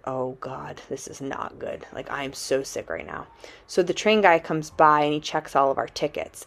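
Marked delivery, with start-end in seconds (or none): 0.1-3.3 whispered dread, half-voiced and soft; a sick, hushed groan to yourself; queasy murmur. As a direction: mutter it like you're about to be sick. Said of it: "And I'm like".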